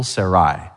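Sarai said with the stress on -rai; stress on second syllable. Shown as a demonstration. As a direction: put the weight on the last syllable of Sarai, sa-RAI. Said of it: "they would emphasize the second syllable".

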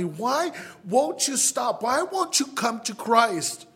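high-pitched, mounting exasperated appeal; frustrated pleading. Acted. Preacher begging someone to come to Christ, exasperated pleading. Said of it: "Haven't you been frustrated sometimes with someone?".

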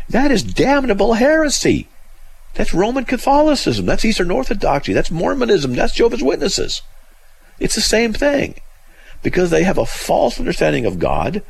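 high-pitched, emphatic outrage; indignant denunciation. Real, not acted. Host denouncing the quote as damnable heresy, high and indignant. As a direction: hit each name hard and high.

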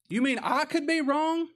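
very high, loud, slow disbelief; exaggerated offended question; mock incredulity. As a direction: act scandalized at the very idea. Mocking someone who refuses fault.

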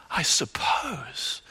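slow, breathy whispered I suppose; preacher whispering Simon's hedge back. Acted. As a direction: hush it as if exposing a secret. Echoes same hedge in whisper.

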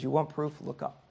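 quiet and gentle, fading; soft fatherly voice. Retold or imitated. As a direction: gently show him the sky. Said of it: "a loving correction ... how gentle".

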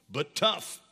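usual speaking voice

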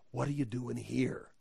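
soft, hushed question; God's quiet voice. Acted. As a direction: ask it low-key and hushed, rising at the end. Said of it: "just kept hearing the voice".

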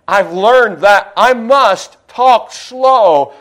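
very slow, drawn-out words; deliberately slowed speech; slow talk. Shown as a demonstration. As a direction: stretch each word out for the seniors. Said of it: "I actually talk slow there".

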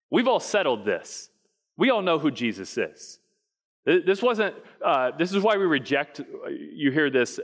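usual speaking voice

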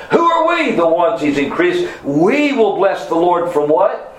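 high, sustained cries on the key words; impassioned preaching. Real, not acted. Preacher telling believers who "we" are, impassioned and high. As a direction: fire questions and answers at the congregation.

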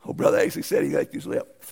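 normal talking voice

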